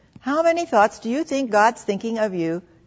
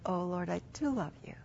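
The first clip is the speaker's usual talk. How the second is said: soft, near-whispered devotion; tender prayer; 13 dB quieter than usual. Acted. Believer in quiet time telling the Lord her love, tender whisper. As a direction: murmur it lovingly, very quietly.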